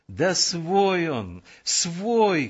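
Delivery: high, drawn-out protest; girl crying out. A narrator's reading. Girl vouching for the lieutenant to the sentries, crying out. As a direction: call it out urgently, stretching the words. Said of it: "кричала Мирочка".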